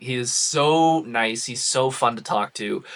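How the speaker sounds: usual speaking voice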